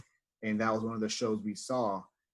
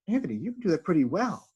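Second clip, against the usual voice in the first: lightly voiced teacher, pitched higher; a quick higher take on his teacher's praise; imitating teacher; 7 semitones higher, 3 dB louder, faster than usual. Teacher praising him, retold.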